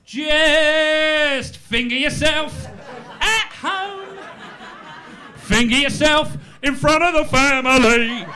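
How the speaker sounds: high, drawn-out cheeky showman; artful dogger. Acted. Street boy teaching the newcomer his trade, high and showy. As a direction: stretch the words high, building to a loud finish. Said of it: "He goes".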